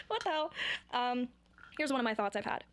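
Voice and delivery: usual speaking voice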